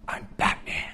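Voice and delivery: hoarse whispered superhero line; Batman. Acted. Batman reveals himself to the thug, menacing whisper. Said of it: "can't really do the Batman voice".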